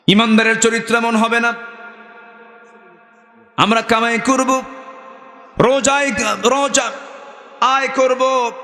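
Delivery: high-pitched sermon delivery; preaching. Acted. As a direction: preach it high and insistent to the congregation. Preaches in raised chant.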